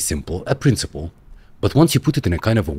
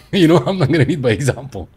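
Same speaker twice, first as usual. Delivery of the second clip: higher, laughing through the words; 7 semitones higher than usual. Real, not acted. Joking to the leadership coach about not leading, laughing.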